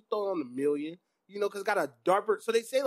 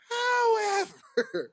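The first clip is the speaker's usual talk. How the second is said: drawn-out however; much slower than usual. Acted. Dramatic pause before the verdict.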